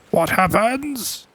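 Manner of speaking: high, drawn-out startled question; slow squeal of surprise. Real, not acted. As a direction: stretch it out high in alarm. First bite while learning to fish.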